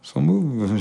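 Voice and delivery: ordinary talk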